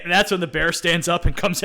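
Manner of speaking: ordinary talk, nothing marked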